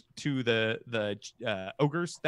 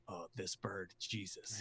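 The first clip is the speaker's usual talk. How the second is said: a soft, exasperated character voice; familiar character voice; 7 semitones lower, 16 dB quieter than usual. Acted. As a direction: mutter it half to yourself, unimpressed. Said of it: "you hear a familiar voice say".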